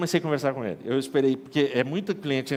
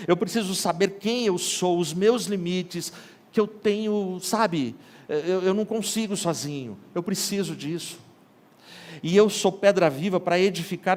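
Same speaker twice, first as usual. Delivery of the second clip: rising preaching; 5 semitones higher than usual. Acted. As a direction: lift it into a full sermon climax. Pastor building to preaching peak.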